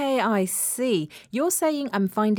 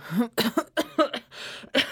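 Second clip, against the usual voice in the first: a bout of mock flu coughs; put-on coughing; 4 semitones higher than usual. Co-host hears rival will be boss, put-on coughing. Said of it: "my flu might be getting worse".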